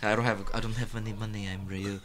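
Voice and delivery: put-on lightly done Indian accent, soft and low; a hint of an Indian accent, pleading; quiet Indian accent with a touch of pleading; mock Indian accent. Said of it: "I gave him an Indian accent".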